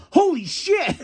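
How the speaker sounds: high startled outburst; shocked exclaim. Retold or imitated. Fan realising he got fooled, shocked. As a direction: blurt it in shock. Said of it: "I was like".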